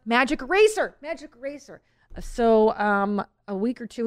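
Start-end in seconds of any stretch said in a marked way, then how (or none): 0.1-1.0 louder, higher correction of the name. Real, not acted. Correcting the product name for listeners, louder. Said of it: "Easy Eraser". 1.0-1.8 softer confirming repeat of Magic Eraser. Real, not acted. Confirming the product name for listeners, softer. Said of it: "Easy Eraser".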